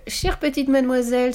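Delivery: exclaiming. A narrator's reading. Tied-up burglar appealing to the girl, exclaiming. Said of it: "s'exclama Carlson l'éclair".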